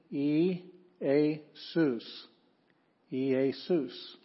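E, a, sus: each syllable said apart, slowly; teacher sounding out a Greek name. Shown as a demonstration. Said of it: "That's Jesus' name in Greek".

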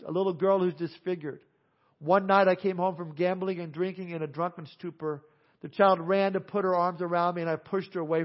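full-voiced revival storytelling sermon; preaching. Evangelist preaching a conversion story to his church, full voice. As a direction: preach the story out loud to the congregation.

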